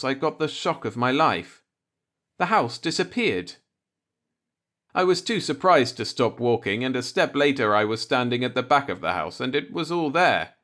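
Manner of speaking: ordinary delivery, nothing marked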